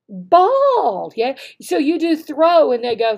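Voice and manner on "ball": calling out. Shown as a demonstration. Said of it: "you call their name and you go".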